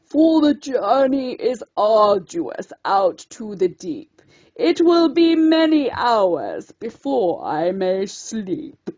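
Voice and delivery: put-on old lobster gently turning down her small friend; cracked ancient voice. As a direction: creak it out like an ancient sea creature. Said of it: "cracked and ancient".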